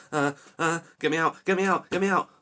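loud rising panicked pleas; frantic pleading. Real, not acted. Gamer stuck in quicksand, pleading in loud panic. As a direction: beg to be pulled out.

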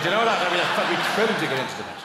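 ordinary delivery

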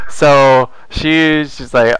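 ordinary delivery

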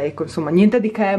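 usual speaking voice

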